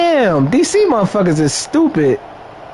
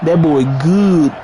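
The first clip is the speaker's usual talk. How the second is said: put-on "That boy good" slow and low, leaning on "good"; drawled catchphrase; 3 semitones lower than usual. Shown as a demonstration. Gamer showing how the catchphrase must sound. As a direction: drag out "That boy good", falling hard on "good". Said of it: "You gotta say it like that".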